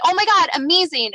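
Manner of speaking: high-pitched retold delight; thrilled relief, acted out. Reacting to good test results.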